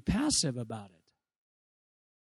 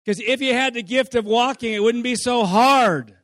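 scolding preacher; 3 semitones higher, 5 dB louder than usual. Retold or imitated. Mock preacher scolding toddler.

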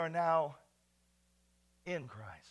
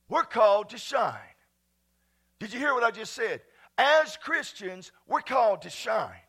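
preaching at full force; 3 semitones higher, 8 dB louder than usual. Acted. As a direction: preach it out to the whole church.

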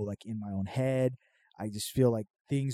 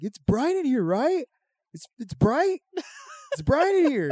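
high, loud insistent yell about the light; stoned yelling; 14 semitones higher, 6 dB louder than usual. Retold or imitated. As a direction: shout it like you're high and the room is blinding. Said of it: "And I was, like, yelling".

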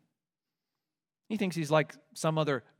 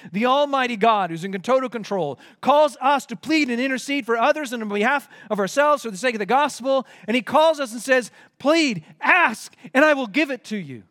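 louder and higher, building to God's promise; rising preaching fervor; 6 semitones higher, 5 dB louder than usual. Acted. Preacher urging the congregation to pray, with full force.

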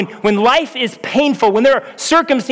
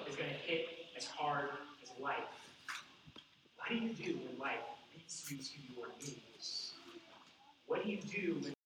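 sudden whisper; 6 semitones lower, 29 dB quieter than usual. Acted. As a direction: drop to a breathy hush on the question. Preacher drops to a hush.

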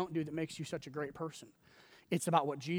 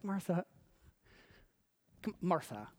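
soft, affectionate calling; gentle, smiling; 8 dB quieter than usual. Acted. Jesus smiling at Martha, gentle and soft. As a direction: coax her softly through a smile. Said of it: "see the smile on Jesus' face".

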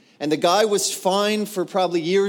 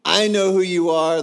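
loud demonic accusation; acted demon shouting at Jesus; crying out; 3 semitones higher, 6 dB louder than usual. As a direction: call it out forcefully. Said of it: "and he cried out".

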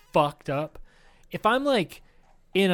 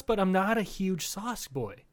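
usual speaking voice

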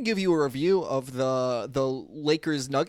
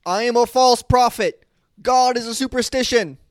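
shouting preacher; 7 semitones higher, 6 dB louder than usual. Acted. Acting villain preacher quote intro.

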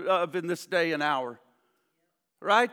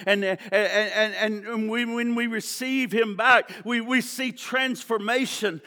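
ordinary talk, nothing marked

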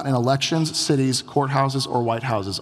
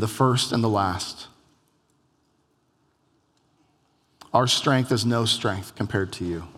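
prayer; 3 semitones lower than usual. Acted. Closing prayer.

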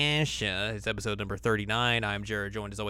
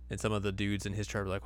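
ordinary talk, nothing marked

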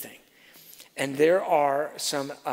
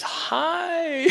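high, laughing sweet hi; sweet wife greeting; 14 semitones higher, 3 dB louder than usual. Retold or imitated. Wife greeting the woman who cursed them, high sweet laughing hi. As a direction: chirp a sugary hello. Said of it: "And Mary Beth goes".

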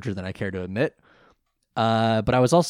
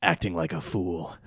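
low villain voice; 6 semitones lower than usual. Retold or imitated. Quoting villain Odeon's retort.

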